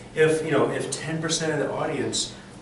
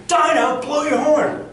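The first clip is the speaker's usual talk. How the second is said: loud, high-pitched yell; shouting captain; 9 dB louder than usual. Shown as a demonstration. Captain calling Dinah to blow the horn, shouted. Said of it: "because the captain is shouting".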